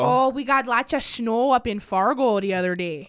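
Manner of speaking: put-on slow, drawn-out North Dakota accent. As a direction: stretch the vowels like a North Dakotan. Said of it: "you're so good at it".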